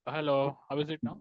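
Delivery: speaking up, closer to mic. Real, not acted. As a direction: push the voice out to be heard. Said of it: "A little better".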